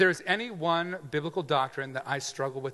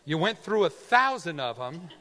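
ordinary delivery

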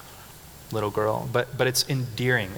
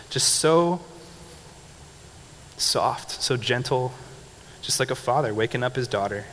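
ordinary talk

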